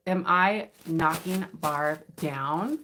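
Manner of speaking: very slow teasing question. Real, not acted. Rephrasing the lawnmower joke, very slowly and teasingly. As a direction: drag it out suggestively.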